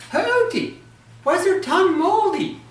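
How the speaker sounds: high, loud sly question; sly wife. Acted. Ogress wife slyly questions her sleeping husband, high. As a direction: coax the answer out of him, high.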